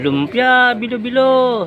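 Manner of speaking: high, loud sing-song lumpia, bilo-bilo; vendor's street call. Retold or imitated. Imitating the merienda vendor calling on the street. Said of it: "sabi ng ganyan".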